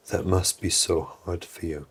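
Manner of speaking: low, very soft empathy; internally calm. Retold or imitated. Parent to an upset six-year-old, calm, low and soft. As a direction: speak low and gently, fully composed. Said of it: "you were internally calm".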